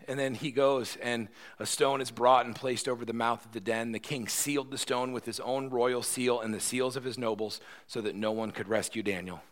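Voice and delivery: ordinary talk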